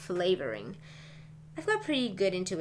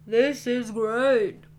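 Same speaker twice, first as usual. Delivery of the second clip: slow and louder, mouth full; 3 semitones higher, 10 dB louder, much slower than usual. Acted. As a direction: talk through a mouthful of food. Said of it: "I said with my mouth full".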